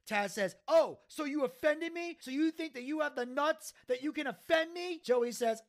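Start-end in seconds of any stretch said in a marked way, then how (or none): 0.7-5.0 high, mock-offended challenge; indignant comeback. Taz twists Joey's apology around, indignant. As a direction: throw the apology back at him. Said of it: "turning everything around on him".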